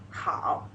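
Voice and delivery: "hao" low and dipping, third tone. Shown as a demonstration. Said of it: "third tone".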